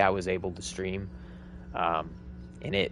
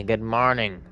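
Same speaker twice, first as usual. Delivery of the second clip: usual speaking voice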